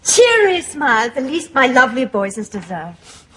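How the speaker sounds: normal talking voice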